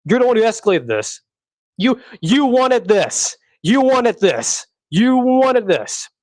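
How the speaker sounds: each word of "You wanted this" dragged out slowly and hammered home; slow pointed emphasis. Retold or imitated. Telling the family who escalated that they wanted this, slow and pointed. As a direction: spell it out slowly, like an accusation that builds. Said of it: "Just tell them".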